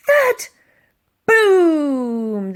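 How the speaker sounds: loud, high crashing boom; loud thud and boom. A narrator's reading. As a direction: blast out the doors breaking. Door smashed by ram.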